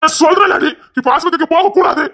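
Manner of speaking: high-pitched, exasperated outburst; angry forbidding. Acted. Husband forbids going to hospital.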